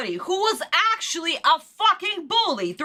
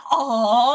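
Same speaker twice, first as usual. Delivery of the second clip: long drawn-out "aww" swoop; mocking sitcom "aww"; 4 semitones lower, 3 dB louder than usual. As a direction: imitate a sappy sitcom audience cooing. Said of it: "Why was there an".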